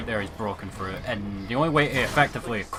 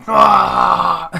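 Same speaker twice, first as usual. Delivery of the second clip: loud, higher-pitched "Ah!"; mock scream; 7 semitones higher, 13 dB louder than usual. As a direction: burst out with a sudden mock yell. Imitating a startled yell.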